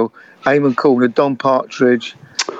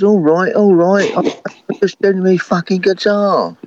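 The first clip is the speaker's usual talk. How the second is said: mock annoyed brush-off to the crowd, pitched up; drunk irritated singer; 8 semitones higher than usual. Drunk singer snaps at clapping crowd.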